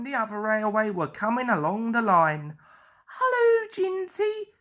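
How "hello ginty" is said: high, sing-song engine hello; whistling greeting. A narrator's reading. Percy greets his friend Ginty, high whistle-like hello. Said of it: "whistled Percy".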